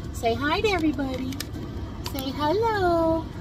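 high pet voice, coaxing. Real, not acted. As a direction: sweet-talk the dog up high. Coaxing dog to greet viewers.

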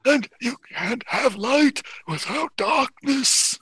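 very high, slow eerie character voice; otherworldly high voice, words stretched; Barmy. Acted. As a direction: intone it high and drawn out. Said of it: "Barmy says".

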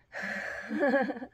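high, loud chuckle; laugh. Real, not acted. After calling herself a honey person, high laugh.

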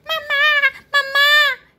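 put-on high, loud child's call; shouting for mama. Baby elephant calls to his returning mother, high shout. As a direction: cry out happily for mom. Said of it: "grita Chiquitín".

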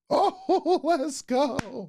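high laughing whoop; real laugh of relief. Real, not acted. Audio fixed, laughing.

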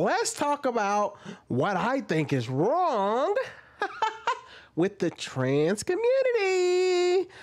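high, slow, sing-song kiddie host voice; mock a perky kids' channel host; childish YouTuber voice. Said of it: "I never talk like a child".

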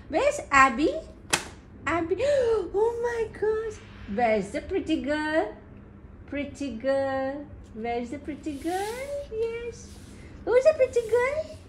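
high, sing-song cooing. Real, not acted. Mother asking baby where the pretty girl is, playful baby talk.

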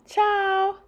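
put-on high, bright child's greeting. Girl answering the restaurant owner, high child voice. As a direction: say it back like a little girl.

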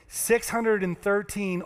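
six hundred and thirteen said slowly, each number weighted; slow it right down on six hundred and thirteen; slow emphatic repeat. Shown as a demonstration. Preacher repeating the number slowly for the congregation. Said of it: "let me say that again".

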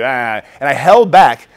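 ordinary delivery

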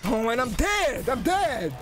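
high, drawn-out wail of defeat; exasperated cry. Real, not acted. Player dies in game on stream, exasperated wail. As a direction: cry out in disbelief at losing. Cries out on losing.

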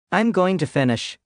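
a hint of a breathless gasp. A narrator's reading. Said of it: "he gasped".

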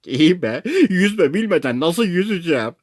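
high-pitched helpless objection; exasperated protest. A narrator's reading. Castaway who cannot swim answers the crew, exasperated protest. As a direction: complain back, voice lifted. Non-swimmer protests the order.

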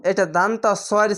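normal talking voice

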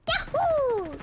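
high whooping game-mascot cheer; Mario. Acted. Mimicking Mario for a friend, high whoop. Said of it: "show me your Mario".

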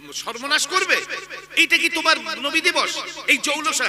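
loud, high rebuke in rushing questions; indignant scolding. Real, not acted. Preacher scolding youths who copy other faiths' processions, indignant scolding.

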